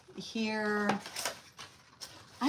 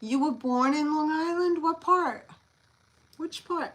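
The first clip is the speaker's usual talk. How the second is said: high, loud and eager; light up at the news; excited question; 7 semitones higher, 6 dB louder than usual. Real, not acted. Seller asks a viewer from Long Island, excited and eager.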